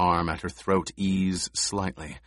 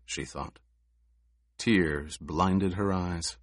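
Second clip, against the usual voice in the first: usual speaking voice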